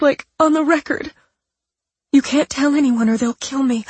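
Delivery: high, frightened, insistent; fake desperate voice. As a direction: plead as if hunted. Patricia's faked desperation.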